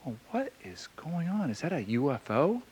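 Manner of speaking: hushed, unvoiced whisper. Standing by the dead truck under the red cloud, a spooked whisper. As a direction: breathe it out, spooked, under your breath. Eerie moment, lights dead.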